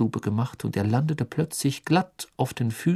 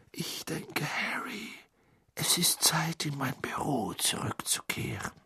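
leise geflüstert, ruhig; 5 semitones higher, 10 dB quieter than usual. A narrator's reading. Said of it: "sagte eine ruhige Stimme in Harrys Ohr". (leise ruhig geflüstert)

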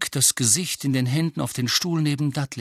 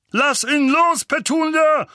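hoch und laut gedonnert; donnernd; 7 dB louder than usual. Acted. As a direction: befiehl es donnernd. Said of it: "donnerte Onkel Vernon". (Vernon donnert Petunia an, den Brief loszulassen)

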